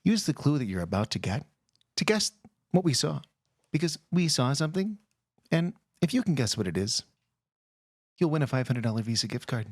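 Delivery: hushed highbrow radio delivery in short phrases; NPR voice. Pretend. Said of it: "I'll use my N P R voice".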